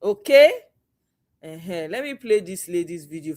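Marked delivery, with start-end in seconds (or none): none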